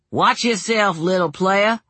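a hint of a swaggering youth; taunting boy. A narrator's reading. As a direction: taunt like a cocky teenager.

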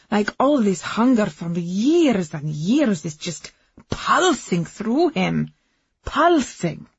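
put-on loud, impassioned foreign-accented woman; lifted voice. A narrator's reading. Woman raving to the whole restaurant about her lover, voice lifted. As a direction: swell with rapture, pitch swooping up on key words. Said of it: "Agnes's voice lifted".

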